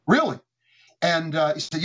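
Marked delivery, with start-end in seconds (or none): 0.1-0.4 a higher, louder "Really?" of disbelief; half-asking, caught off guard; surprised. Retold or imitated. Told he'd be CEO, he answers surprised.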